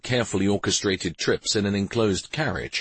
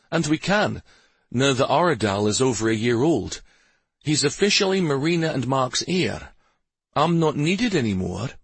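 higher-pitched, mildly bitter grumble; bitter complaint; 6 semitones higher than usual. A narrator's reading. Husband telling his wife he's no use, mildly bitter. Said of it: "came out as a bitter-sounding complaint".

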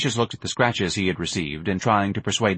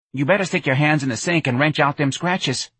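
lightly voiced nagging mom; a hint of folksy mothering; fretful mother; 7 semitones higher than usual. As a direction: nag in a higher, homely voice.

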